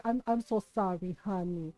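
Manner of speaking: low, soft auntie consolation; retold gentle comforting. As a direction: soothe a child softly and low. Kind tita comforts the cousin.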